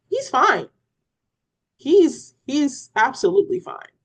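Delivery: emphatic reassurance. Retold or imitated. Reassuring friend about son.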